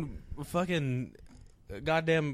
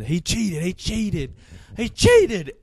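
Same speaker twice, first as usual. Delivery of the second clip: mock protest rising from loud to a full yell; a heel's complaint climbing higher and louder; building protest; 6 semitones higher, 10 dB louder, much slower than usual. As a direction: whine it louder each time, ending in a shout. Protesting into the mic.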